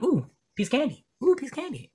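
very high, cartoonish little voice; tiny easily distracted critter, cooing high; distracted squirrel. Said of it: "I'm a little squirrel".

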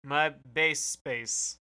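very slow, savoring each word; slow and deliberate. Acted. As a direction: spell the hashtag out slowly. Coining a hashtag slowly.

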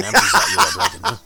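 high, loud, breaking into laughter; laughing while talking. Real, not acted. Friend riffing on Kyle in the van, cracking up. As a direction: crack up as the joke lands.

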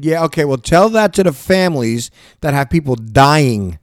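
ordinary talk

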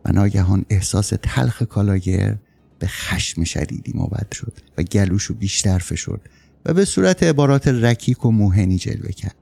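usual speaking voice

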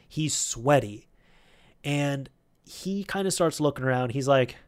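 usual speaking voice

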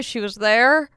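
usual speaking voice

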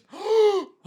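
loud, high theatrical gasp; exaggerated intake of shock; mock horrified gasp.

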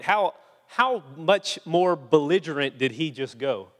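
ordinary talk

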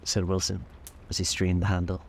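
usual speaking voice

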